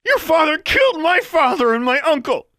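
very high, loud accusation; furious shout. A narrator's reading. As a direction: scream it at the enemy's child. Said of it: "Harriet shouted".

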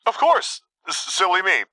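high, stammering squeak. A narrator's reading. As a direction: squeak weakly, tripping on the words. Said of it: "Quinn finally squeaked".

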